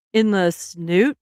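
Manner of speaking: slow, incredulous repeat with a rising end; questioning echo. Retold or imitated. Husband queries her odd word for nose, slow questioning echo. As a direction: imitate him querying the words, drawn out. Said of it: "He was like".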